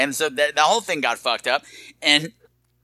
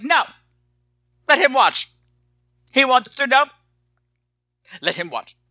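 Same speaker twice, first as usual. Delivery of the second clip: Bette Davis impression; 9 semitones higher than usual. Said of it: "I love your Bette Davis".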